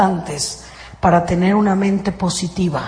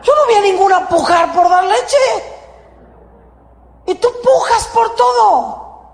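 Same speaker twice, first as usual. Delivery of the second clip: much higher, shouted punchline; preaching shout; 10 semitones higher than usual. Real, not acted. Preacher climaxes about straining.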